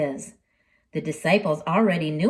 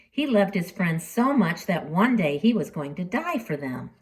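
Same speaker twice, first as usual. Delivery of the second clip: ordinary delivery, nothing marked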